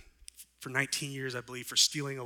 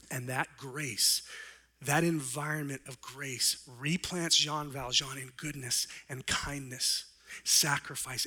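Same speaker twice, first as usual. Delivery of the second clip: ordinary talk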